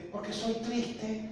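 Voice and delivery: high, slow, small voice; retold timid answer; meek confession. Repeats his timid answer.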